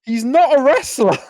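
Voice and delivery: high-pitched, incredulous gushing; amazed admiration. Real, not acted. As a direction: marvel at it, pitch way up. Gushing over a non-wrestler's nerve.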